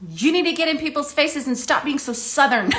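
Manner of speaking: high, loud mock order with laughter behind it; quoting blunt friend. Camera expert friend telling her off, high mock scolding. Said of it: "and I quote".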